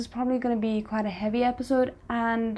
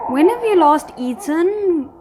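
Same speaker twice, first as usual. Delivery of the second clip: calling out; 7 semitones higher, 7 dB louder than usual. Real, not acted. Calling out to on-screen character.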